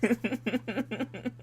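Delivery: high squeal of laughter through words; laughing. Real, not acted. Laughing at friend's joke.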